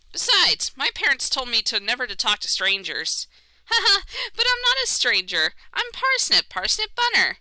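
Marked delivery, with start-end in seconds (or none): 3.7-7.4 much higher, chirpy bunny character; high rabbit voice. Acted. Player voicing Parsnip to a stranger, high cheerful rabbit. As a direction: play a cheerful little rabbit.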